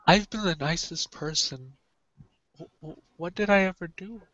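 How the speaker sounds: soft, plaintive self-pity; quiet lament. Acted. Shamash looking up to the sky, lamenting quietly. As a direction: plead gently with the heavens.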